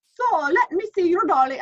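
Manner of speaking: high, loud Irish brogue; an Irish priest asking a little girl kindly; Irish priest accent. Retold or imitated. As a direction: put on a thick Irish brogue. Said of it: "I don't do accents well, so excuse the accent".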